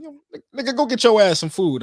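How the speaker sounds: high voice swelling loud on the order; drunk uncle. Retold or imitated. Uncle ordering nephew to eat, high then loud mock voice. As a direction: bark it like a tipsy uncle. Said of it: "He's like".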